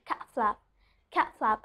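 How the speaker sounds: "cat flap" said softly with a stopped t; don't release the t in "cat flap"; "cat flap" with the t swallowed before f; unreleased T. Shown as a demonstration. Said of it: "I'm not pronouncing the letter T".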